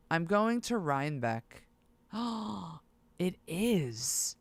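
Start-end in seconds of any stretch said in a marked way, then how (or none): 2.1-2.8 a short sharp breath in; quick startled gasp; surprised gasp. Real, not acted. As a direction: gasp at a bargain. Finds a surprising price.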